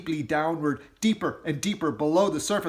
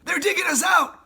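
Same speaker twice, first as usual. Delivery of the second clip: high, loud alarm; urgent shout; 8 dB louder than usual. Acted. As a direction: warn the family of danger. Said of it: "he shouted".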